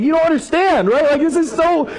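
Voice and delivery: high-pitched, worked-up pleading; acted-out frantic objection; distressed protest. Protesting to wife who laughed.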